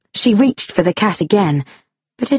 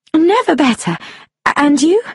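flustered; 7 semitones higher than usual. Acted. Flustered, blushing after rescue.

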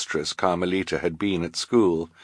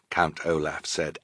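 plain reading voice

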